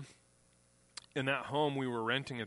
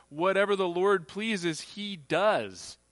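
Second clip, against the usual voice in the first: slow emphatic reading; 5 semitones higher, 6 dB louder, slower than usual. Acted. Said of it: "That's Psalm one thirty-five".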